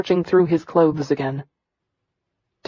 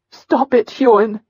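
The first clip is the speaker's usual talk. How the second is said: shouting; 5 semitones higher than usual. Acted. Wife stops husband's beating.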